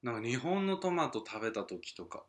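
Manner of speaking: usual speaking voice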